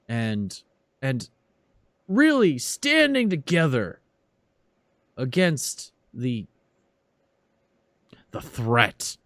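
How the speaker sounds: schmooze the crowd, then darken at the scourge; bigoted congressman. Acted. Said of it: "he says".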